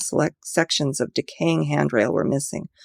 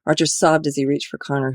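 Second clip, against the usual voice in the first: plain reading voice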